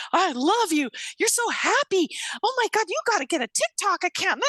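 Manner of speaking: high, excited gush; gushing fans. Retold or imitated. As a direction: imitate starstruck girls gushing over someone. Voices the gushing daughters.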